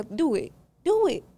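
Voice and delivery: mock taunt rising to a high challenge; taunting dare. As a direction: dare her, climbing in pitch.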